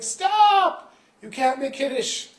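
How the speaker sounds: very high, loud "stop"; urgent shout. Acted. Boy interrupts a third time.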